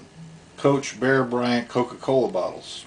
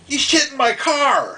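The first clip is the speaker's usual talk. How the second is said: Kyle yelling; 3 dB louder than usual. Retold or imitated. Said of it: "and Kyle's like".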